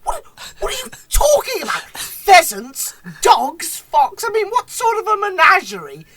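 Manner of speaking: high, loud indignant tirade; David Mitchell losing it. Retold or imitated. Retelling a panel show outburst, imitated exasperated rant. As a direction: rant in mock outrage, building in disbelief. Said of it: "David just absolutely loses it".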